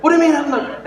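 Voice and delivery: high-pitched mock outrage; retold angry retort. Angry mother retorts to her son, mock-indignant. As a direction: imitate an indignant aunt, voice shooting up. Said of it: "she got even more angry".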